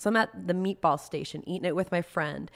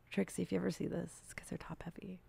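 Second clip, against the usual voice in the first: hushed whisper to the camera; soft whispered confession; whispered aside; 12 dB quieter than usual. Real, not acted. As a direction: whisper it like a secret message.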